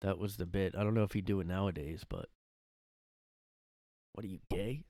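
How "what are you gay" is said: lightly imitated Boston needling; mock Boston talk. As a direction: toss out the taunt Boston-style, ending on a rise. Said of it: "that's how people talked".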